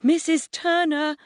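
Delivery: high, anxious call; pleading. A narrator's reading. Neighbour boy calling up to the mum, pleading. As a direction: call up to her imploringly. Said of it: "gazes up pleadingly".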